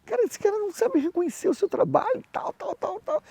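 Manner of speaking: a hammed-up indignant wife complaining; imitating his wife. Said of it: "Minha esposa falou". (esposa imitada, voz bem mais aguda e indignada)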